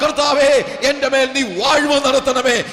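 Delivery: crying out to God. Real, not acted. Preacher crying out in prayer.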